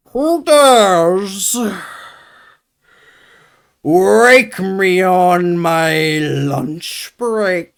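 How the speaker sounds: booming slow dragon voice; ancient rasping undead king. Acted. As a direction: roar it out like a woken dragon. Dragon woken, speaks as monster.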